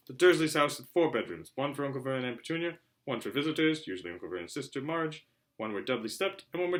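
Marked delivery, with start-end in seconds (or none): none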